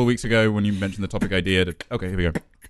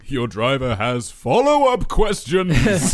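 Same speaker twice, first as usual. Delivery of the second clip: laughing; 3 semitones higher, 6 dB louder, much slower than usual. Real, not acted. Joking, laughing.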